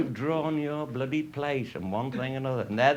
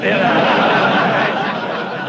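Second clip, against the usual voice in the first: breathy loud laughter; wheezing laugh; 7 semitones higher, 12 dB louder than usual. Real, not acted. Laughing at punchline.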